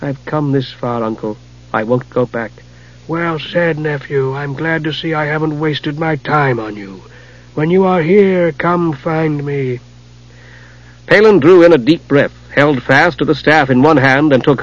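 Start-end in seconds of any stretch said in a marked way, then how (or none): 0.0-2.5 quiet. Acted. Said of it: "he said quietly". 3.1-9.8 slow, soft, ominous wizard; soft rasping wizard. Acted. Raistlin's voice.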